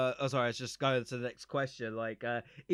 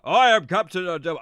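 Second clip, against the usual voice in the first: louder, commanding captain; 5 semitones higher, 10 dB louder than usual. Retold or imitated. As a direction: announce yourself like a ship's captain. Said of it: "And so I was like".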